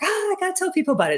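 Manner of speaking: high and fast "Ah, I gotta tell people"; bursting to share the news; quick, lifted rush of enthusiasm; eager burst. Retold or imitated. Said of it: "and I'm like".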